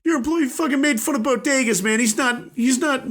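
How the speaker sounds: put-on high, heated Boston accent. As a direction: complain like an angry Boston guy. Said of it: "I'm saying this in a Boston accent".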